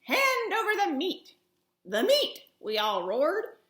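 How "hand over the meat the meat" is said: louder, raised pirate shout; pirate demand. Acted. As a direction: bang the table and demand meat.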